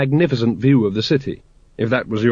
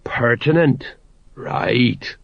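sagely nodding sergeant; much slower than usual. A narrator's reading. Sergeant repeating Vimes's word back to him, sagely knowing. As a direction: echo the word as if wisely understanding. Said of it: "The sergeant nodded wisely".

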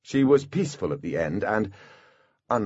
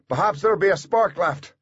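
higher-pitched, pressed voice; bodyguard, urgent; 10 semitones higher, 3 dB louder than usual. A narrator's reading. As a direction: offer a rescue urgently.

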